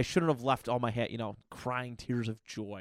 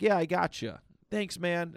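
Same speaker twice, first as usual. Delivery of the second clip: upbeat, higher-pitched quoted agreement; agreeable; 6 semitones higher, 4 dB louder than usual. Retold or imitated. People pleaser agreeing to a friend's favor, upbeat and higher. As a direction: say yes readily, pitched up.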